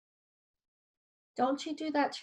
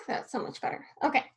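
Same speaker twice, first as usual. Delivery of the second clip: ordinary talk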